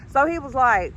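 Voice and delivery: ordinary talk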